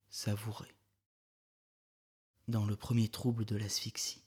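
plain reading voice

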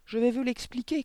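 high, loud, valiant schoolboy; trumpeting. A narrator's reading. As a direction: pipe up boldly to the rescue. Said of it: "claironna le valeureux Victor". (claironnant, voix aiguë)

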